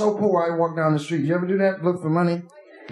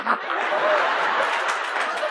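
very high unvoiced drunken cackle. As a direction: let out a wild drunk cackle. Drunk whoop.

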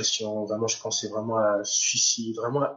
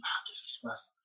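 whispered inner thought; 12 semitones higher, 11 dB quieter than usual. Retold or imitated. Inner thought, high unvoiced.

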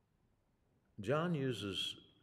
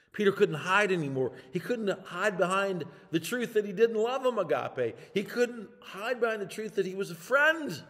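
much higher, louder preaching climax; full-force preaching; 11 semitones higher, 12 dB louder than usual. Acted. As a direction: drive it home to the congregation.

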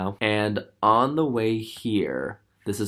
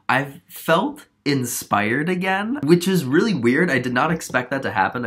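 excited; 5 semitones higher, 6 dB louder than usual. Real, not acted. Gushing about renewed inspiration.

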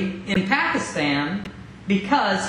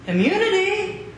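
pleading; 9 semitones higher than usual. Acted. Pleads for immunity.

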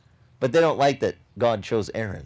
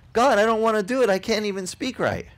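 pleading Moses; 7 semitones higher than usual. Retold or imitated. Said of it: "And so he said".

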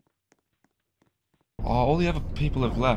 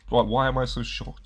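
ordinary talk, nothing marked

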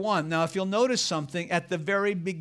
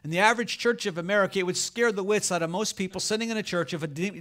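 normal talking voice

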